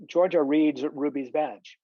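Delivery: usual speaking voice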